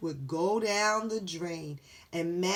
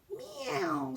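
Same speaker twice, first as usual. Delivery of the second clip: cat meow; 13 semitones higher, 4 dB quieter than usual. Acted. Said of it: "said Dakota".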